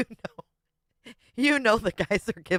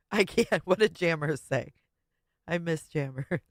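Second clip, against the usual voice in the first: ordinary talk, nothing marked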